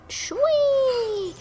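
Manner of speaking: high, loud joyful cry; gleeful whee. Real, not acted. Riding along in the game, a high gleeful squeal. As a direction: let out a thrilled ride squeal.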